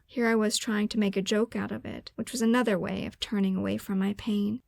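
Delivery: plain reading voice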